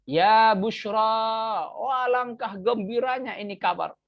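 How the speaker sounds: high, drawn-out cry of good news; joyful exclamation. Shown as a demonstration. Teacher acting out an Arabic example of joy, joyful exclamation. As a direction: demonstrate delight at happy tidings.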